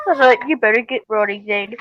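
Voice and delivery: ordinary delivery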